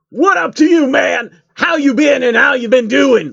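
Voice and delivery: high, loud hammed-up rapper voice; over-the-top rapper call-out. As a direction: holler across the studio at your buddy. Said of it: "[name] called to [name]".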